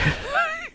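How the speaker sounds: shaky laugh after a fall; pained laugh. Real, not acted. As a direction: laugh through the pain.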